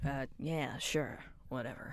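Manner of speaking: low, soft dismissive mumble; mumbled whatever. Acted. Sulky character brushing off a question, low mumble. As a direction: shrug it off under your breath. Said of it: "retake against whisper".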